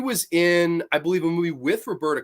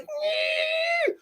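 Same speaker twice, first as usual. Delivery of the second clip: very high strained squeal; constipated straining. Retold or imitated. Imitating the actor straining at the actress, high mock squeal. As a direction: imitate pushing hard, a long high whine. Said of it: "He looks like he's constipated".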